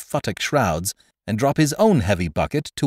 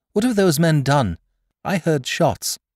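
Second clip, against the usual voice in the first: put-on higher-pitched, urgent girl; girl's high questioning voice; 7 semitones higher than usual. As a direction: demand answers as the alarmed girl. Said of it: "the girl's voice thrust in".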